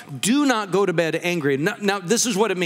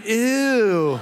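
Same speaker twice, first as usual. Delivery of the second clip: wrinkle your nose at it; wife reacting to the mud video with a mock "ew"; disgusted; 3 semitones higher than usual. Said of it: "And she's going".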